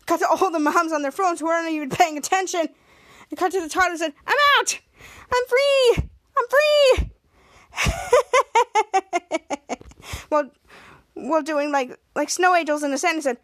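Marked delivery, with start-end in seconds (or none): 4.3-7.1 high, loud cry of freedom; squealing triumphant cheer; gleeful shout. Retold or imitated. Toddler escapes pit, cheering. 7.8-9.7 high gleeful laugh; high triumphant villain laugh; villain cackle. Retold or imitated.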